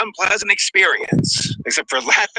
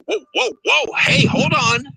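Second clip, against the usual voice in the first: higher-pitched mock "whoa, hold on" reaction; imitated startled interruption; 7 semitones higher than usual. Retelling fiance halting the film, mock startled. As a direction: cut in like a startled viewer.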